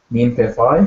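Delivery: "Min pe phi" with the voice rising on "phi"; question inflection. Shown as a demonstration. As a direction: ask "Min pe phi", lifting the final "phi". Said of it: "there's an inflection at the end".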